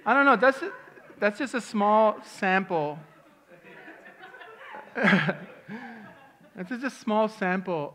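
laughing. Real, not acted.

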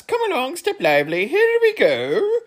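high, loud excited character voices; eager shouted welcome. Acted. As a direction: call them along cheerfully. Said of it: "they shouted".

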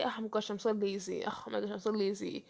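quick, soft grumble; lazy self-talk. Acted. Host acts out someone always calling themselves lazy, soft quick grumble. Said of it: "If you always say".

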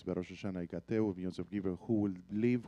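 normal talking voice

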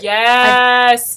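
a loud delighted yes; excited yes. Real, not acted. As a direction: cheer it out. Excited agreement over favourite album.

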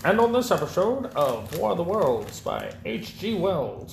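high, far-off radio announcer; 1950s announcer. Pretend. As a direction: introduce the episode like old radio.

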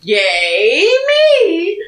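imitated cheer. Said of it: "So Rob is thinking".

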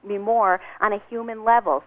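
normal talking voice

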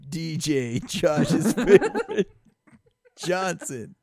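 slow, loud, high reveal with laughter; mock award announcement. Co-host revealing Josh's game ball winner, laughing mock announcement. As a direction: draw out the name like a big winner.

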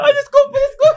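high-pitched laughing apology; laughing sorry. Real, not acted. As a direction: squeal sorry while cracking up. Listener laughing apologizing mockingly. (pedindo desculpa rindo, voz aguda)

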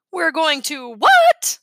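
put-on a stunned boy who can't believe it; shocked disbelief. A narrator's reading. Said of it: "starting to choke".

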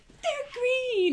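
mock brown-eyed friend. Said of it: "they're always like".

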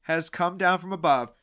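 ordinary talk

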